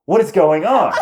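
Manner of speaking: loud, high mock-panicked shout; freaking out. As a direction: yell it out in exaggerated bewilderment. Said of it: "I'm, like, freaking out".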